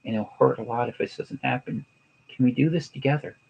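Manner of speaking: softly earnest plea to a wife; feelings-first appeal. Shown as a demonstration. Demonstrating to his wife how to ask, soft heartfelt appeal. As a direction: speak gently and sincerely, seeking validation. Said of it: "here's how I would do it".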